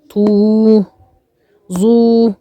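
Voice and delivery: طو and ظو each held long and level; madd with waw. Shown as a demonstration. Teacher asking which is madd, long held طو and ظو. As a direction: stretch the oo in طو, then in ظو. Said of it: "مد بالواو ومد بالواو".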